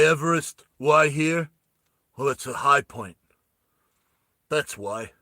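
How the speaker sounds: a hint of a weary soldier coming clean; lightly voiced Hammy. Hammy confessing.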